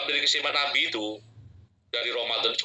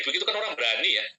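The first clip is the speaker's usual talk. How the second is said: normal talking voice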